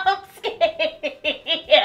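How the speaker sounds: high, loud wail through laughter; panicked laughing cry, easing down at the end. Real, not acted. Wax is on and no going back, laughing panic. Cannot undo applied wax.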